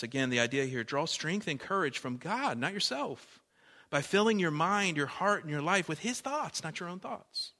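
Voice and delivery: fervent sermon build; preaching. Acted. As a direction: drive the point home from the pulpit. Preaching with rising force.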